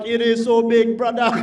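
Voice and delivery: lightly put-on Jamaican accent; mock Jamaican accent. Comic replying to his girlfriend in bed, a light mock Jamaican accent. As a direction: slip into a playful Jamaican accent. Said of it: "sometimes I just turn Jamaican".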